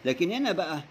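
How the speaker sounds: ordinary delivery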